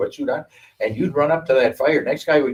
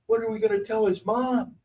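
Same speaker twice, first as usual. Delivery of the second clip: worried higher voice; 10 semitones higher than usual. Retold or imitated. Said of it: "Lee looks at me and goes".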